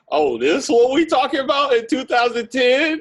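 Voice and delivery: high-pitched, laughing astonishment; amazed disbelief. Retold or imitated. Friend reacting to a bold song, incredulous.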